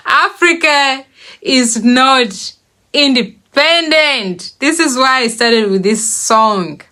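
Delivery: normal talking voice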